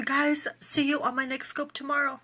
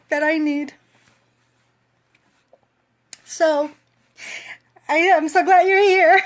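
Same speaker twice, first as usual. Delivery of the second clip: higher, loud, crying voice; tearful; 7 semitones higher, 7 dB louder than usual. Real, not acted. Vlogger thanking her coach, tearful and loud. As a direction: push the words out through tears. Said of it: "Oh my God, I'm crying".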